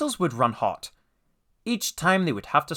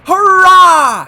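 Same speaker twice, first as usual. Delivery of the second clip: long, loud, high cheer; triumphant; 16 dB louder than usual. A narrator's reading. James over the hilltop, cheering in triumph. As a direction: let out a soaring cheer. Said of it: "he puffed triumphantly".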